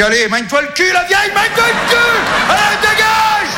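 put-on high, yelling out the car window at an old driver; hammed-up driver's yell; mock road-rage yell. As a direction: bark it out fast, then drag out the last shout.

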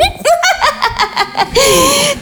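high, overtired mother's laugh; forced laugh. Acted. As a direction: laugh as if close to losing it. Exhausted sister's laugh.

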